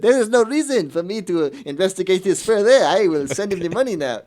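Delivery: a high, exaggerated French accent; mock French accent. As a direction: play the gullible lady in a silly French accent. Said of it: "I kept doing the French accent".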